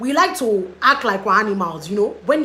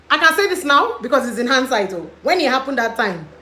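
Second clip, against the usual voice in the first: excited; 4 semitones higher, 7 dB louder than usual. Real, not acted. Loud excited retelling.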